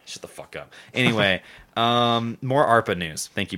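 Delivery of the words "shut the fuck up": breathy whispered retort. Real, not acted. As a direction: breathe it out under your breath. Mock disbelief, muttered.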